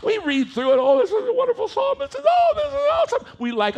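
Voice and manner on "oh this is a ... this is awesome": mock gushing. Mocks churchgoers gushing over psalm.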